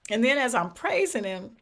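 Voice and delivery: ordinary talk, nothing marked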